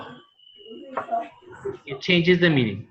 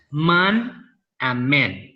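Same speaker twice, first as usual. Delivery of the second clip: slow example words; much slower than usual. Shown as a demonstration. Teacher shows a plural pair.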